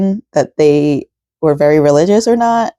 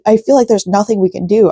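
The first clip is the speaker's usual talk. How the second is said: ordinary delivery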